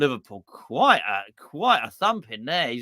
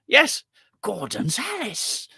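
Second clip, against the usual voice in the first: mock grand pronouncement; 7 semitones higher, slower than usual. Triumphant slowed catchphrase.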